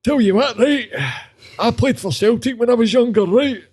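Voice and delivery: high, exaggerated Glasgow patter; pure Glasgow accent. Glasgow pub bragger about playing for Celtic, high exaggerated Glasgow accent. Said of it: "that Glasgow accent? See, like, that pure".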